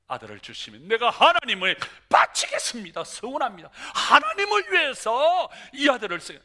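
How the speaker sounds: loud, ringing vow; fervent vow. Acted. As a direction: proclaim the promise with full force. Hannah's vow, preached loudly.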